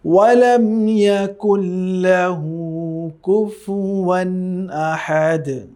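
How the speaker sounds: slow melodic tilawat; Quran recitation. Shown as a demonstration. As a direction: chant it slowly, drawn out like in prayer. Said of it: "আমি আবার পড়ছি".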